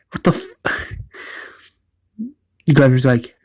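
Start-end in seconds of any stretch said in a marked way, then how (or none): 0.1-0.9 high, unvoiced, shocked whisper; breathless disbelief, cut off mid-word; shocked gasp. Shocked on seeing fish tanks.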